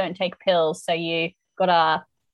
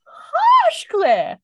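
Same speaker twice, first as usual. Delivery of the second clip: shocked exclamation; 8 dB louder than usual. Real, not acted. Reacting to Claire's cold reply.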